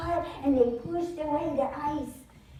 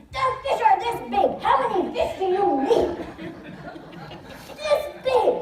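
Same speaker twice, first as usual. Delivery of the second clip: higher, much louder, laughing through the brag; mock excited bragging; 9 semitones higher, 10 dB louder than usual. Mimicking the fishers bragging about their tiny catch, laughing loudly. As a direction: crow about tiny fish, giggling.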